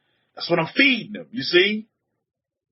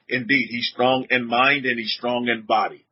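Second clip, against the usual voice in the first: usual speaking voice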